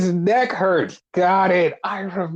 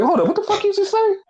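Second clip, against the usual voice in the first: fast, much higher incredulous comeback; mock indignant challenge; 14 semitones higher, faster than usual. Host voices Hangman snapping back at an insult, high and indignant. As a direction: imitate an affronted wrestler, pitch shooting up. Retold confrontation between two wrestlers.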